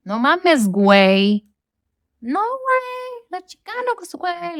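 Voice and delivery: high, drawn-out Mexican Spanish slang imitation; hammed-up Mexican accent with stretched-out vowels. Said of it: "the Mexicans have their own s-, accents".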